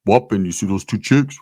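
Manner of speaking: imitating a cousin's deep pick-up voice. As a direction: drop from squeaky to deep. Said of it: "All hyphy voice turns into".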